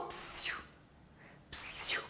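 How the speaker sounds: hissed "psiu" to get attention; short, breathy psst at someone; psst to call someone. Shown as a demonstration. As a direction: catch someone's ear with a psst. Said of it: "tentando chamar alguém".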